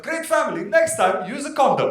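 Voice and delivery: high-pitched mock praise, then jab; mimicking backhanded compliment; retorting in kind. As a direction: throw the heckle back, pitched high. Comedian mimics the heckle back.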